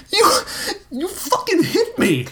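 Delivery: very high, strained yell; high-pitched indignant shout; outraged shout. A narrator's reading. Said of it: "he shouted".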